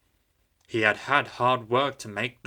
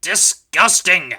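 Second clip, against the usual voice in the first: slow, loud, higher disgust; indignant; 8 semitones higher, 9 dB louder, slower than usual. A narrator's reading. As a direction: spit out the word in scorn. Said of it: "put in James".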